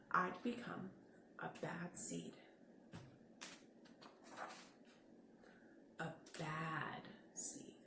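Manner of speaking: slow low whisper; hushed ominous whisper; secret whisper. A narrator's reading. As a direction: breathe it like a dark confession. Seed admits dark secret.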